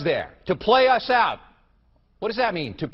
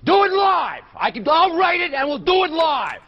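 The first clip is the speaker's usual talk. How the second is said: enraged, much higher and louder shouting; furious yelling; 10 semitones higher, 5 dB louder than usual. Real, not acted. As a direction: lose your temper completely and roar. Host explodes at broken teleprompter.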